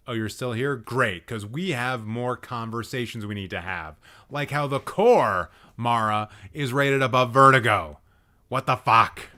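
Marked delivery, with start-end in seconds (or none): 4.3-9.3 loud, emphatic indignation; indignant outburst. Real, not acted. Streamer confronting friend over The Core's rating, loud indignation. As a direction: hammer the complaint home loudly.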